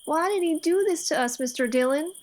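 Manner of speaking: loud, pained cry of why; anguished outburst. Acted. The boy cries out to the marshal about losing the farm. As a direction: let the hurt burst out loud.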